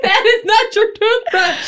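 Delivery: high, slow, laughing protest; laughing high mock outrage. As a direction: protest through tears of laughter. Acting out toothbrush scene.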